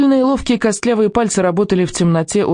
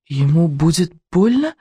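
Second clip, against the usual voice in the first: worried; 2 semitones higher than usual. A narrator's reading. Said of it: "умирая от беспокойства".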